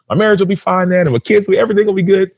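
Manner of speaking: high, lifted voice full of hope; imitating his younger self, pitched high and eager; hopeful daydream. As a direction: sell the dream to yourself, way up high. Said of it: "I'm like".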